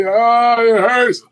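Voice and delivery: high-pitched imitation of someone hurting; mock pained wail. Injured inmate complaining to the officer, mock high-pitched wail. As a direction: whine it high like a hammed-up injured man.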